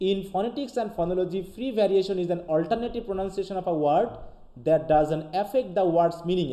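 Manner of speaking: slow, careful English read-aloud; slow dictation read. Shown as a demonstration. Teacher reading the definition slowly to students.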